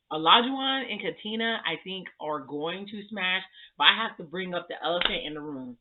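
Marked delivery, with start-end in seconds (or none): none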